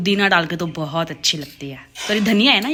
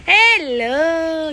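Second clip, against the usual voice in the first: greeting the dog; 9 semitones higher, 7 dB louder, much slower than usual. Real, not acted.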